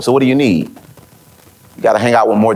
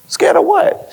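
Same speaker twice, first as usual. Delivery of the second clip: can't believe what you just heard; high, startled question; incredulous; slower than usual. Retold or imitated. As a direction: leap way up in pitch, baffled. Retold astonished reply to boss.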